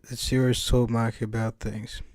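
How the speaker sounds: usual speaking voice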